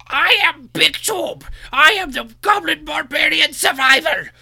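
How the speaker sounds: high, loud, slow battle-cry shout; goblin barbarian voice. Acted. Introducing his goblin to the table, high shouting. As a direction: bellow it like a tiny goblin warrior boasting. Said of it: "I actually do a voice for the character".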